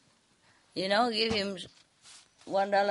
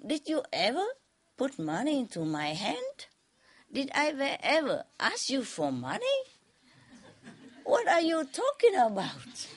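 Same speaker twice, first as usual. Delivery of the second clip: teasing; 7 semitones higher than usual. A narrator's reading.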